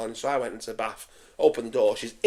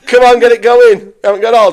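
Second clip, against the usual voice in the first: raised, higher voice, hurrying them along; loud chivvying; 7 semitones higher, 15 dB louder than usual. Real, not acted. Host hurrying friends into a drink, loud urging. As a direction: loudly urge the lads on.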